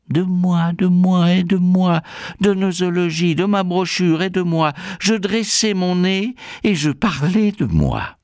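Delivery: high, insistent litany about oneself; vantardise insistante. Acted. Narrateur imbu de lui-même, litanie. (litanie vaniteuse, haut perchée)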